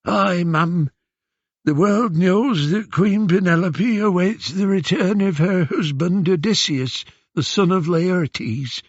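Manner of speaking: put-on high, whining old man with eastern Aegean accent; old man's accented whine. Disguised Odysseus answering Queen Penelope, old man's accented whine. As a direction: disguise yourself as a foreign old beggar. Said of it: "into the old man's hoarse whine that Athena had implanted, infusing it with an accent".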